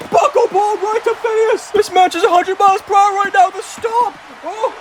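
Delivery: high-pitched, urgent match call; excited play-by-play. Acted. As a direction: call the big moves as they land. Commentator calls big moves.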